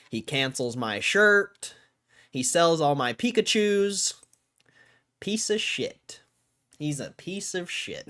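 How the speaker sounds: loud, rising tirade; comic rant. Real, not acted. Streamer ranting at the CEO, loud and rising.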